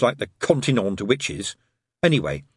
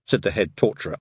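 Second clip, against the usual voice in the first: usual speaking voice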